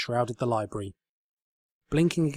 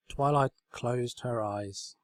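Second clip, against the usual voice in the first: plain reading voice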